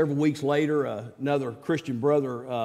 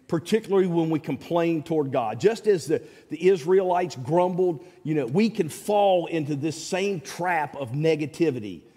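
normal talking voice

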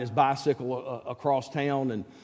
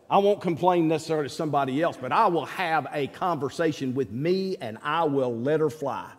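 ordinary talk, nothing marked

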